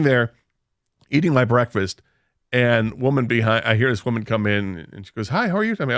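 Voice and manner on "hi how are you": high, sing-out hello from a stranger; imitated polite greeting. Woman coming in greets a lone diner, politely.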